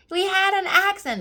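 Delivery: high, loud mock kid cheer; screaming kids. Kids screaming happily after the crash, high and loud. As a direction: shout it up high like giddy children. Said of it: "They keep screaming".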